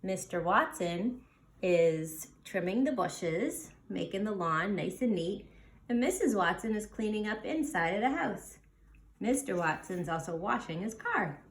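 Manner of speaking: ordinary delivery, nothing marked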